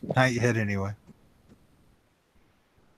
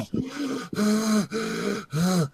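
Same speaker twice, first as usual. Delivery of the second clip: heavy gasp; 10 semitones higher, 3 dB quieter than usual. Shown as a demonstration. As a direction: gasp like a pregnant woman in labor. Demonstrating heavy breathing.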